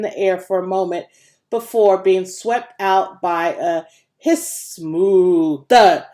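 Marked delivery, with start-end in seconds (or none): 4.2-6.1 hiss, long far-off moo, loud thud; voiced hiss moo thud. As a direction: sound out the machine noises. Slaughterhouse noises voiced in narration.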